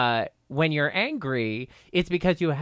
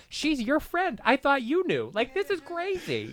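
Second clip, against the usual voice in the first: very high-pitched retold exasperation; mock bewildered protest; 12 semitones higher than usual. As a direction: squeal a helpless disclaimer in mock panic. Retold panicked reply to Bianca.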